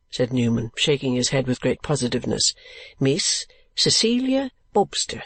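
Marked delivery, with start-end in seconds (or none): 3.0-5.2 proud announcement. A narrator's reading. Newman announces name proudly.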